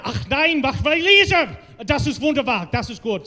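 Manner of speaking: hammed-up, emphatic mock German; German accent. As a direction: put on a punchy German accent. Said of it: "Ooh, German".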